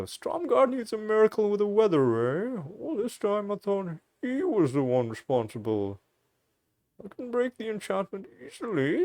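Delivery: put-on high, thin elderly hermit voice; old person voice. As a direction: play a frail old hermit. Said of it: "that was my old person voice".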